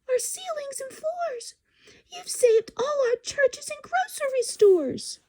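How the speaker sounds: a grateful tiny person far below; tiny Who voice. Acted. The speck voice speaks.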